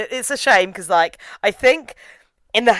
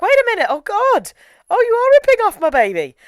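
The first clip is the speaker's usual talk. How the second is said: much higher, shocked fan squeal; mock outrage; 5 dB louder than usual. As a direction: gasp at the rip-off. Indignant fan reaction quoted.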